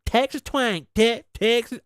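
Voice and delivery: high-pitched, badly faked Texas twang; attempted Texas twang. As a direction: have a struggling go at a Texas accent. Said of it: "How the fuck do you do it?".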